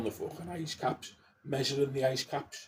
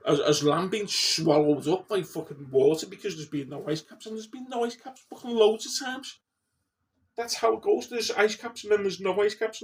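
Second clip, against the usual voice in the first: higher and louder, heated ranting; worked up; 6 semitones higher, 4 dB louder than usual. Real, not acted. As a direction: get more and more wound up as you argue. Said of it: "I'm getting all worked up now".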